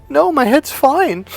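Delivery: high, slow, tearful mock voice; imitated crying girlfriend. Girlfriend answering him after her fall, mock tearful. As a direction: put on a whimpering high girl's voice. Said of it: "crying and crying and crying".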